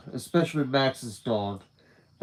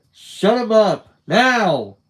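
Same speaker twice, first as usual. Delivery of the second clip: higher, louder furious command; shouting; 8 semitones higher, 9 dB louder, much slower than usual. Acted. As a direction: bark the order at full force. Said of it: "shouted at Max".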